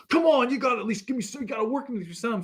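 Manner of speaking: high, fast, whiny haggling; mock upset pleading. Rich buyer pleading with the wife for a discount, high and fast. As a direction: rush it like a frustrated buyer losing the deal. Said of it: "getting upset now".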